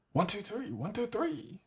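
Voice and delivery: high count to test compression; high mic test. Shown as a demonstration.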